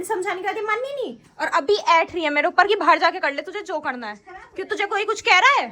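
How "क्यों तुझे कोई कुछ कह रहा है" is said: louder, raised scolding. Real, not acted. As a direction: tell the niece off sharply. Scolding the disobedient niece.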